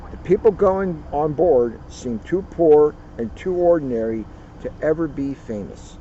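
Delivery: usual speaking voice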